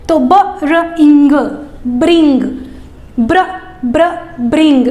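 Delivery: ब, र, इंग said apart, then ब्र, then ब्रिंग; teacher modelling a consonant blend; sound blending. Shown as a demonstration. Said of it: "इस तरह से बोल करके सिखाना है".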